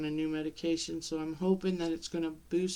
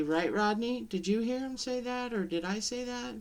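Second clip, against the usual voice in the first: put-on higher, rising questions to a little child; playful questioning; 6 semitones higher than usual. Mother getting little son in on the joke, playfully questioning in a higher voice. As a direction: pitch it up as if asking a toddler to back you up.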